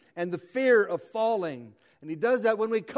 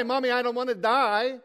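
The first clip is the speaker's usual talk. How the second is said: put-on higher, plaintive little child begging mommy; frightened child; 5 semitones higher, 4 dB louder than usual. Child at bedtime crying out to mommy, frightened and higher. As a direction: plead like a scared kid at bedtime.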